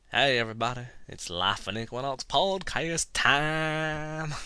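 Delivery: slow Southern show-opener, last word stretched out; horrible southern accent. Acted. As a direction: announce it in a hammy, drawn-out Southern accent. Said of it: "so here's my introduction".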